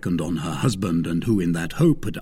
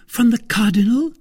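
high, louder questioning wife; taken aback; 14 semitones higher, 7 dB louder than usual. Acted. Wife guessing the source of the money, taken aback. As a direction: ask it in disbelief.

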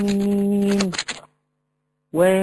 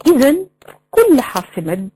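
usual speaking voice

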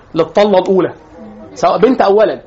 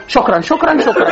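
imitating a little girl, higher pitch; طفلة صغيرة; 6 semitones higher than usual. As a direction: rattle off thanks like a coached child. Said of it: "أول ما حد يديها تقول له إيه". (تقليد بنت صغيرة بصوت أعلى)

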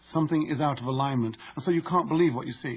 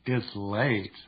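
low, teasing "It's late" with a lingering "its"; flirtatious; 4 semitones lower than usual. Shown as a demonstration. Demonstrating flirtation to the reader, flirty. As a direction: invite them to stay.

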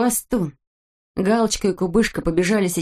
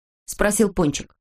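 ordinary delivery

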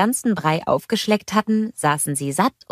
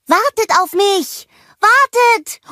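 very high, loud plea; calling after; 6 dB louder, slower than usual. A narrator's reading. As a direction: shout desperately for the racers to wait. Olaf ruft den Radfahrern nach. (hoch und laut hinterherrufen)

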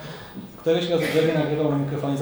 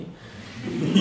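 short breathy laugh; 2 semitones higher than usual. Real, not acted. Laughing at washing-machine comparison.

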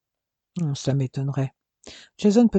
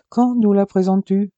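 louder, higher pressing question; insistent; 5 semitones higher, 5 dB louder than usual. Acted. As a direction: insist on an answer. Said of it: "insista son frère".

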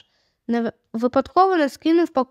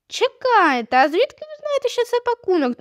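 much higher-pitched, impulsive question; impulsive blurt; 11 semitones higher than usual. A narrator's reading. Julia unable to hold back a question, high impulsive blurt. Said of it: "не втрималась Джулія".

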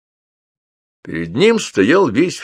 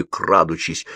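ordinary delivery

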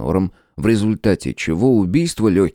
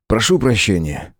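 ordinary delivery, nothing marked

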